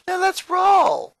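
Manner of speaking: rally the team with gusto; excited call. A narrator's reading. Luann rallying Lincoln for the party, excited shout.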